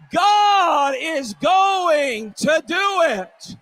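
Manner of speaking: high, loud, slow drawn-out shout. Real, not acted. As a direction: shout it out, stretching every word. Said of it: "we shout it even louder".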